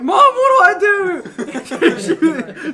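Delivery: high, loud, slow panicked plea through laughter; a kid begging mom in panic. Retold or imitated. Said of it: "I was like".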